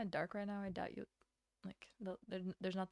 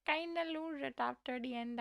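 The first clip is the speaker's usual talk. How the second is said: Stitch voice slipping; 4 semitones higher than usual. Shown as a demonstration. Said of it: "I just sound stupid".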